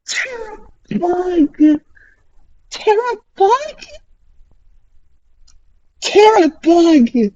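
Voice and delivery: high, disbelieving cry of the name; incredulous shout. Real, not acted. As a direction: say it like you can't believe it. Shocked at enemy move.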